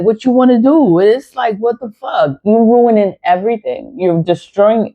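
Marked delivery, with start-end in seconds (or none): none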